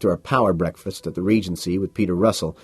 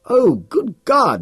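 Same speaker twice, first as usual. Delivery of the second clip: much higher, slow, drawn out; exaggerated disbelief; mock exasperation; 5 dB louder, much slower than usual. Carnes scoffs at Bateman, much higher and slow.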